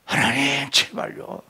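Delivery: soft, low whispered plea to God; hushed desperate prayer; pleading prayer. Shown as a demonstration. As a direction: beg God almost without voice.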